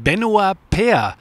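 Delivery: ordinary talk, nothing marked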